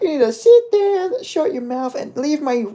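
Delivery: hammed-up Irish trash talk, slow and cocky; mock Ian Garry. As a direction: puff up like a fighter copying McGregor. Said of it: "try and talk like McGregor".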